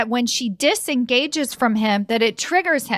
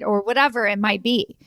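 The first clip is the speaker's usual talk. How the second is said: ordinary talk, nothing marked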